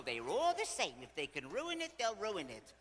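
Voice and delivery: high, loud communal grumbling; chorus of griping friends. Retold or imitated. Three friends agreeing husbands spoil everything, griping. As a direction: imitate old friends piling on in outrage. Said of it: "all the three others go".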